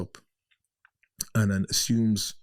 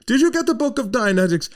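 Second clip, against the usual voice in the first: German woman on the phone; 13 semitones higher, 7 dB louder than usual. Retold or imitated. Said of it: "she rang me up".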